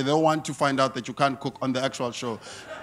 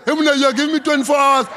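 mock outrage; 9 semitones higher, 4 dB louder than usual. Scolding the Woolworths label.

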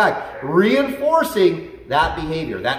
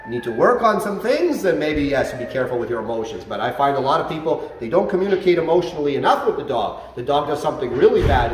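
normal talking voice

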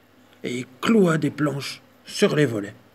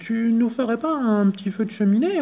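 higher cheeky mouse voice; daring mouse; 8 semitones higher than usual. Acted. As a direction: ask boldly as the mouse. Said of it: "osa la souris".

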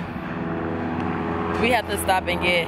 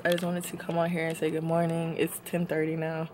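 ordinary talk, nothing marked

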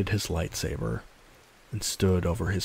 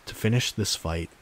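usual speaking voice

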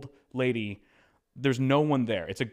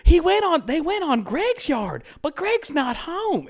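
put-on very high, loud, slow old lady chatter; old neighbour telling the host about Greg's yard; quirky old lady; 8 dB louder, slower than usual. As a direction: gossip like a small-town granny, pitched way up.

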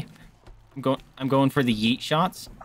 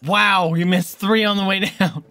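loud, high disbelief; amazed exclamation; 6 semitones higher, 5 dB louder, slower than usual. Real, not acted. Player watching a friend fail, loud amazement. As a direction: call out the miss in amazement.

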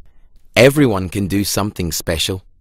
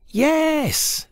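much higher squeaky vegetable yes; tiny high cauliflower voice; cauliflowers' voice. A narrator's reading. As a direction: chirp it like a cauliflower. Cauliflowers answer Pumpkin.

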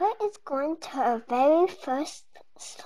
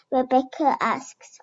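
ordinary delivery

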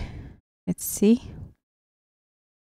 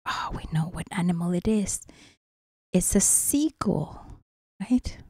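whispering; 7 dB quieter, faster than usual. Real, not acted. Aside whispered to listening child.

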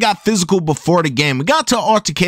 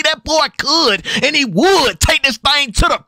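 hype call; 6 semitones higher than usual. Acted. Calling a big touchdown play.

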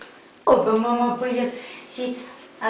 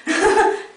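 short high laugh; 8 semitones higher than usual. Real, not acted. Joking to puppy.

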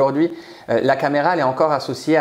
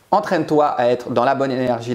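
ordinary delivery

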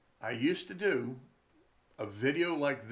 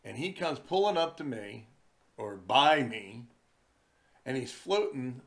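ordinary delivery

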